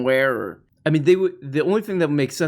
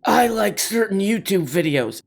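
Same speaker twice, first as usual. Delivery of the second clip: mocking protester; 6 semitones higher than usual. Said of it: "give my sign".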